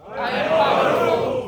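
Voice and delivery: shouted affirmation, slow and huge; booming motivational speech. Shown as a demonstration. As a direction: roar it back like a crowd chant. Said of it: "repeat after me".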